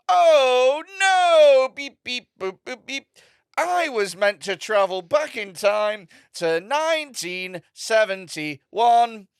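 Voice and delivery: high, slow, loud robot lament with beeps; robot character. Retold or imitated. Robot act dismayed at the year, drawn-out mock-robot voice. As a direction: wail like a mock robot, vowels held long. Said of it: "Comes on stage as a robot".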